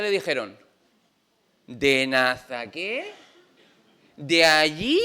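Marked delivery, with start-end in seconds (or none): 1.7-5.0 slow, disbelieving question with a jump up at the end; exaggerated "from where?" disbelief; mock incredulity. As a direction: act scornfully baffled by the place. Acts disciples scorning Nazareth.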